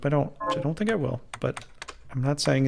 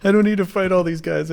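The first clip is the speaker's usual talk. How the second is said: frustrated outburst; 7 semitones higher, 5 dB louder than usual. Retold or imitated. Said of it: "I was like".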